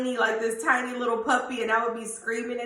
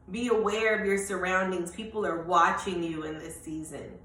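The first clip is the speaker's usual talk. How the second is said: usual speaking voice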